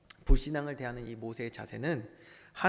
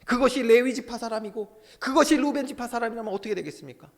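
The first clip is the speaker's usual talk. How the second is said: loud, high-pitched pulpit cry; impassioned preaching; 8 semitones higher, 7 dB louder than usual. Real, not acted. Preaches at full force.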